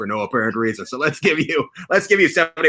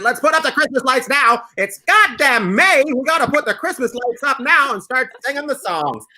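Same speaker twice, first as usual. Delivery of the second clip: high, excited imitation; mock excited Taylor; 9 semitones higher than usual. Host mocking Taylor's Christmas excitement, high and eager. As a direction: hype it up like a Christmas fanatic. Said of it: "Taylor said".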